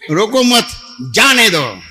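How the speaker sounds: loud call with the pause after "मत"; misplaced pause. Retold or imitated. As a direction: call out "रोको मत", break, then "जाने दो". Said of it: "तो जो अल्प विराम".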